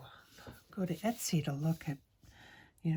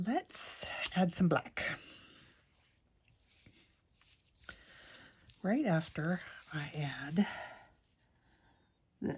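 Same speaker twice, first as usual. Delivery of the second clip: ASMR whisper. Real, not acted.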